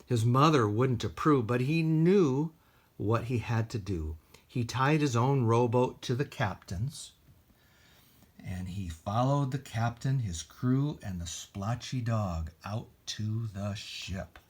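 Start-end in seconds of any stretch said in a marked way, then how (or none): none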